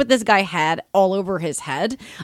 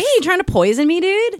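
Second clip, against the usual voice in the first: indignant; 10 semitones higher than usual. Pretend. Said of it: "I would've been like".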